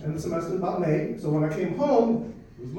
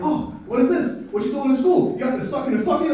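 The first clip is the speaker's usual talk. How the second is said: scolding mom; 6 semitones higher, 4 dB louder than usual. Retold or imitated. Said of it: "She saw, saw my grades, like".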